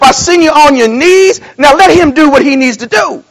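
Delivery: fired-up sermon delivery; preaching at full force. Real, not acted. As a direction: preach it hard to the congregation. Preaching builds up.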